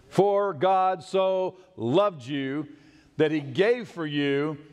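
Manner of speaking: slow emphasis. Shown as a demonstration. Preacher rereading the verse slowly to his church. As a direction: linger on each word. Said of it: "emphatic slow verse".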